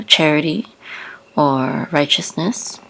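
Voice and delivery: ordinary delivery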